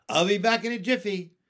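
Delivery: bright, quick, loud call; cheerful call. A narrator's reading. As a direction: toss it off brightly and fast. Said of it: "she said".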